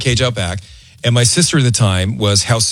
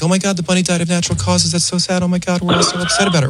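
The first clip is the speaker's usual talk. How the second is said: fake sadness; 6 semitones higher, faster than usual. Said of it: "just pretend like".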